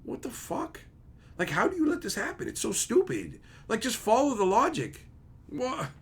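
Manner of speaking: high-pitched exasperated complaint; baffled brain. Acted. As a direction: squeak out bewildered protest without volume. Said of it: "when that dumb shit happens, it just goes".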